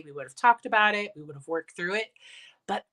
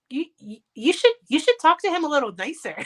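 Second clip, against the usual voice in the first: mock husband; 8 semitones higher, 4 dB louder, faster than usual. Husband's retold scolding.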